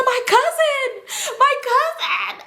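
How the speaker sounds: very high, drawn-out mock wail; hammed-up sobbing cry for someone lost. Girl pulled away while missing her cousin, acted crying wail. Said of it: "I'm crying".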